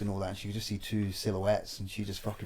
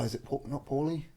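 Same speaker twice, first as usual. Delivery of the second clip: normal talking voice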